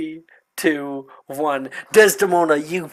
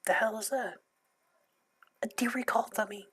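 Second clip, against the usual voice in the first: ordinary delivery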